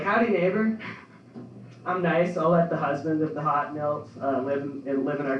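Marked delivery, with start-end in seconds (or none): 0.0-0.8 smug greeting. Retold or imitated. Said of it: "I'll just be like".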